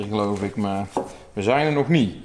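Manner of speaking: ordinary talk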